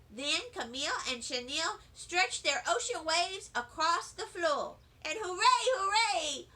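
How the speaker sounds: usual speaking voice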